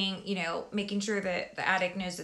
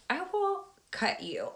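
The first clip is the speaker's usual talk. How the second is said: mock threat; 9 semitones higher, 4 dB quieter, slower than usual. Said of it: "And I'm like".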